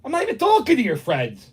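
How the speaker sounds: high, indignant denial; annoyed protest. Real, not acted. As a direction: protest to someone across the room. Argues with person off mic.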